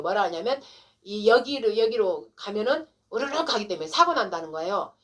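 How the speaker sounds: usual speaking voice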